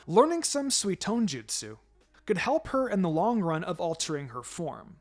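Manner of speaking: ordinary delivery, nothing marked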